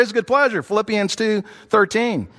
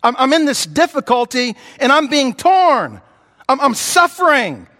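higher, louder cry of distress; desperate plea to God; anguished prayer; 6 semitones higher, 3 dB louder than usual. Retold or imitated. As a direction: pray like someone being torn apart. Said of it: "when we're pulling apart at the seams".

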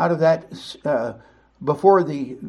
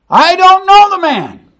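much higher, much louder and slow; desperate denial; 13 semitones higher, 10 dB louder than usual. Acted. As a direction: drag it out in a near-screaming protest. Peter's second, stronger denial.

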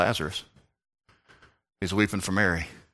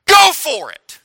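much higher, loud cry; urging shout; 8 dB louder, slower than usual. Acted. Preacher egging the worshipper on with a loud high cry. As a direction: throw it out to the whole room.

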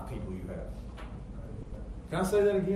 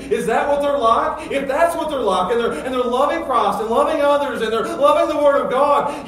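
raised questions climbing to loud, high preaching; building sermon crescendo; 9 semitones higher, 10 dB louder than usual. Real, not acted. Preacher testing whether followers love Christ, building impassioned preaching. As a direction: build each question to full pulpit force.

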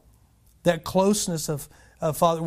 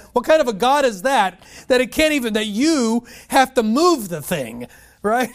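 higher, louder outraged cry; indignant preaching; 6 semitones higher, 6 dB louder than usual. Real, not acted. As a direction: build scornful disbelief, slowing. Indignant preaching about idols.

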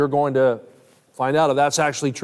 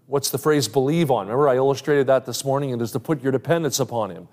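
normal talking voice

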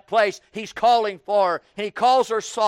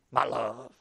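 much softer, lower tag after the scolding; sudden soft my love; 10 semitones lower, 9 dB quieter than usual. Pretend. Preacher ending mock rebuke with a hushed my love. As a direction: drop to a hush. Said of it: "He don't talk to her that way".

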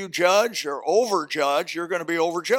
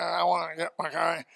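put-on softer, slurred drunk voice; drunk deacon; 4 dB quieter than usual. Drunk deacon asking for his car, softer slur. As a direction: slur it like a drunk man at the tire store. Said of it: "he's talking like this".

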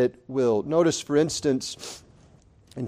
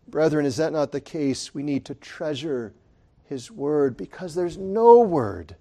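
ordinary delivery

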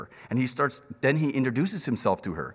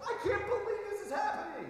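much higher, softer gasp of wonder; amazed disbelief; 10 dB quieter than usual. Retold or imitated. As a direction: Ruth overwhelmed by the blessing. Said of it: "and she goes".

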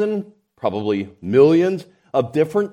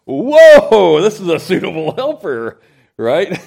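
amazed whoa; 5 semitones higher, 9 dB louder than usual. Acted. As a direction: gasp it out like a man dazzled by his bride. Adam amazed by Eve.

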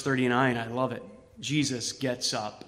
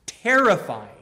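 usual speaking voice

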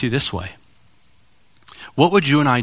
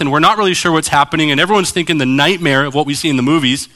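usual speaking voice

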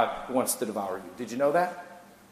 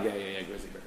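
dismissive; 6 semitones lower, 14 dB quieter than usual. Retold or imitated. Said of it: "and you're like".

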